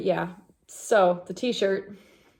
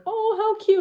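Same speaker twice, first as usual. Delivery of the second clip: much higher, cooing "oh how cute"; mock cooing stranger; 6 dB louder than usual. Strangers cooing over the cute dog, imitated high coo. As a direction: coo like someone charmed by a dog. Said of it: "And they're like".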